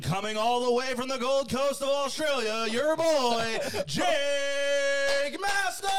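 high, stretched-out hype announcer shout; ring announcer. Acted. Host introducing a guest from the Gold Coast, announcer shout. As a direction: draw out the name like a fight-night announcer.

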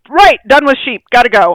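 loud, high, brisk exit line; a dog huffily walking off the field, loud; loud mock quitting dog. Owner voicing her dog quitting herding, loud and high. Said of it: "She's like".

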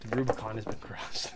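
breathy dramatic whisper; mock solemn whisper. Echoing his friend's vow, a mock-ominous whisper. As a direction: whisper it like a grave omen.

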